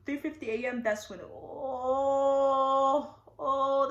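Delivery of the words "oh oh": long, loud held groan; drawn-out mock agony "oh"; pained moan. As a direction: moan it out, reenacting labor pain. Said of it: "the pain".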